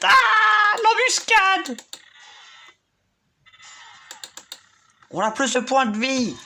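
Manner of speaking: high, loud battle cry; shouting commands. Pretend. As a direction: bellow orders at the troops.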